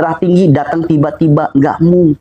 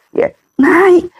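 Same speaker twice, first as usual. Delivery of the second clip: squeaky high "naik"; 13 semitones higher than usual. Acted. Pressure rising, pitch jumps up.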